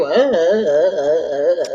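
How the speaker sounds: slow, wavering sobs; drawn-out bawling noise; mock crying. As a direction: imitate a kid blubbering. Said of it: "he just kept".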